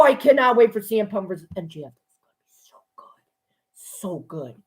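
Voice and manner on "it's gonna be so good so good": whisper. Real, not acted. Hushed anticipation.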